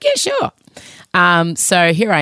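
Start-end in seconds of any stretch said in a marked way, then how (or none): 0.0-0.5 much higher, chirpy mock agreement; producer's breezy yes. Imitating her producer agreeing, chirpy and high. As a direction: toss it off like an easygoing producer. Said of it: "He's like".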